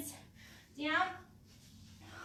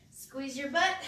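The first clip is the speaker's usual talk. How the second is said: normal talking voice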